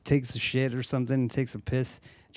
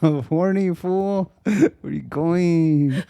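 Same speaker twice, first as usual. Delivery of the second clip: much higher, louder slow pleading Chicano voice; mock Chicano plea; 8 semitones higher, 6 dB louder, much slower than usual. Chris Pratt begging the fleeing woman, hammed-up Chicano accent. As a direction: beg her not to leave, hammed up.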